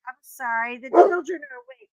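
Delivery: ordinary delivery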